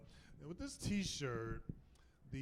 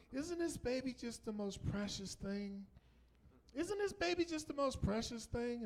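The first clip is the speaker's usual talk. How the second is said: doting parent; 10 semitones higher than usual. Retold or imitated. Doting parent showing the nurse their precious baby, high gushing coo. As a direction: coo proudly in a mock high voice.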